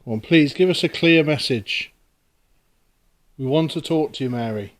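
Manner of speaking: loud pleading call to the spirit. Real, not acted. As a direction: beg the spirit for a message. Calling out to a spirit.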